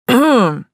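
loud attention-getting "ahem"; pointed throat-clearing. A narrator's reading. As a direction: clear the throat to call them to order. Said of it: "Вернул нас с неба на землю граф". (громко, многозначительно откашляться)

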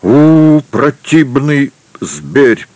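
loud, high hoot, then slowly drawn words; stretched-out exclamation of revulsion; disgusted exclamation. A narrator's reading. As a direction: recoil from the beast with a long high cry. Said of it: "воскликнул каталонец".

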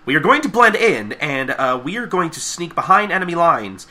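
high, hammed-up commanding briefing; mock drill-leader announcement; Pinky. Pinky briefing the girls and dragon, high mock-commander voice. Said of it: "Pinky's just like".

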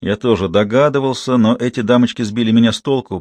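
plain reading voice

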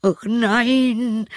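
sehr hohe, flehende Stimme des Wirts; kleinlaut jammernd. Acted. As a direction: winselnd um Gnade betteln. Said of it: "rief der Wirt ganz kleinlaut". (kleinlauter Wirt jammert)

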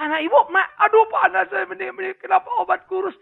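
high and loud, a mock wailing parent; distraught parent. Preacher voicing a grieving parent, loud and high. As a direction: cry out like a desperate mother.

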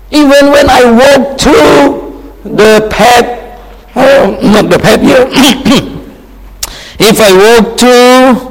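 high, loud shouted declaration; crying out. Retold or imitated. Preacher shouting David's words of trust to the congregation. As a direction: proclaim it boldly, full voice. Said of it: "David said".

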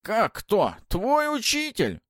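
высокий медленный скрипучий голос ведьмы; многозначительно, как всезнающая старуха; голос Бабы-Яги. Acted. Баба-Яга раскрывает тайну. (скрипучий голос старухи)

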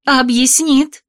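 high, slow disbelieving echo; shocked echo. A narrator's reading. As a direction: nearly howl it in dismay. Heroine repeats in shock.

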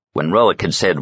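plain reading voice

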